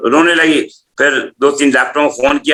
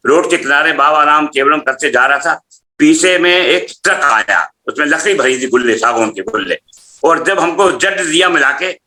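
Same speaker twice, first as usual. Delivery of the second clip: usual speaking voice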